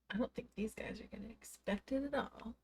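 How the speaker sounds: hushed, voiceless aside; conspiratorial whisper. Real, not acted. Streamer lets viewers in on a surprise raid, whispering. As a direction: whisper it like a secret plan.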